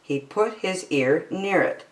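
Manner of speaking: usual speaking voice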